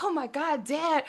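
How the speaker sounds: high, gushing to dad; excited daughter. Retold or imitated. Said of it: "I was so excited".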